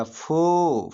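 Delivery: फो held long on the vowel; isolated syllable. Shown as a demonstration. Teacher having students repeat along, फो drawn out. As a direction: stretch फो out as one syllable. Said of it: "तो आप मेरे साथ बोलिएगा".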